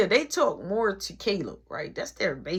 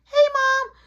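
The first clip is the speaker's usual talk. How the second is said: put-on high, loud childlike greeting; eager little boy; 6 dB louder than usual. Little brother to mom on the phone, high eager greeting. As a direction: chirp it up high.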